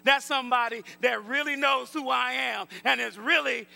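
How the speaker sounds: loud preaching shout as God answering; retold delighted recognition. God hears someone use the real name, loud excited recognition. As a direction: call out "Hey" strongly, then keep it loud and emphatic. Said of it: "he's like, Hey".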